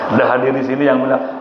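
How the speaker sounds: ordinary talk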